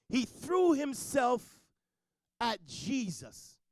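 ordinary talk

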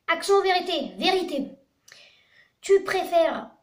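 usual speaking voice

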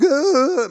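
loud, high mock sob; hammed-up wail of helpless crying; imitated blubbering. Showing how he cried at the finale, blubbering. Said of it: "I was blubbering. I was like".

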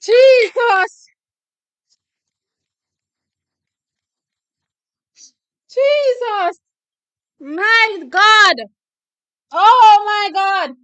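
high, loud, drawn-out cries of disbelief; stretched, high-pitched exclaiming; overjoyed exclamation. Real, not acted. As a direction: cry out in real amazement at the win. Overjoyed reaction to the race.

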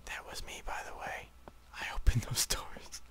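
hushed secret whisper. Real, not acted. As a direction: whisper it like a confession. Sneaky confession to teammate.

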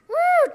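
high, loud "woo"; excited whoop. Pretend. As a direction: whoop with joy at flying. Rudolph boasts he can fly.

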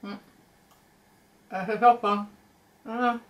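chewing while talking; talking through hand; mouth full of chocolate. Real, not acted. Seen 2: Woman bites chocolate held at mouth, then keeps chewing behind hand.

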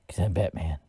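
a light touch of Batman's gravel; gravelly Batman voice. Shown as a demonstration. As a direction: play it as a mock Batman, only a hint gruffer. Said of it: "That's my gravelly voice".

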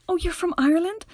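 high, impressed mock question; hammed-up interested outsider; curious foreigner. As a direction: imitate a gushing stranger asking. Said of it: "Or else people being like".

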